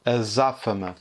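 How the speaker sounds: Azáfama with the stress on zá; Azáfama, accented syllable zá; stress on second syllable. Shown as a demonstration. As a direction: lean on the zá of Azáfama. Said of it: "je vous présente les mots azáfama".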